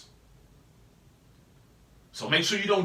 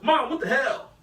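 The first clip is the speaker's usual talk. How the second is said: higher-pitched exasperated yelp; startled mild outburst; 7 semitones higher, 4 dB louder than usual. Acted. Child to mom who stepped on their foot, startled yelp. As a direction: protest to Mom, short of cursing her. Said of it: "'Cause she stepped on my foot".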